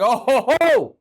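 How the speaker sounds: hammed-up gloating commentator; mock gleeful pundit. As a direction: ham up a smug analyst tossing a curveball. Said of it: "He was like".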